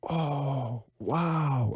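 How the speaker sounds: long, hushed, drawn-out awe; amazed students. Retold or imitated. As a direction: softly marvel as the penny drops. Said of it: "their eyes light up".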